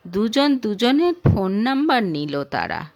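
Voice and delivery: usual speaking voice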